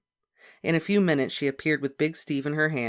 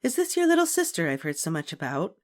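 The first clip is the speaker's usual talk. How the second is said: librarian; 5 semitones higher than usual. A narrator's reading. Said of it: "said Miss Evans".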